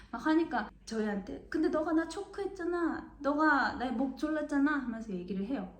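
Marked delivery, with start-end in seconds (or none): 1.5-4.7 whiny protest. Retold or imitated. Boy protests being choked.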